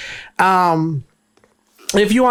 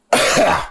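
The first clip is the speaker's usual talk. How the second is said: breathless cough. Real, not acted. After singing, out of breath.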